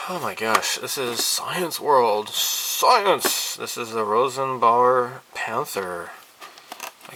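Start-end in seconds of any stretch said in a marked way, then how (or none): 2.3-3.6 high, loud exclaimed word; mock exclamation. Vlogger reading the kit brand, mock excited shout. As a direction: blurt it like a TV show title.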